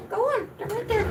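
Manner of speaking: pet talk. Real, not acted. Urging dog.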